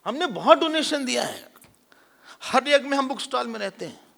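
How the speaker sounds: high-pitched, puffed-up bragging; boastful ego. Acted. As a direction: play someone showing off good deeds. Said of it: "अहंकार".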